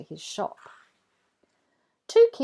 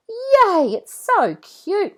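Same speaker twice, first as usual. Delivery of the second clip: high, loud, drawn-out joy; delighted squeal; 13 semitones higher, 9 dB louder, much slower than usual. A narrator's reading. Rebecca thrilled with her robot dog, squealing. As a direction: squeal over a new toy. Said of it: "Rebecca says".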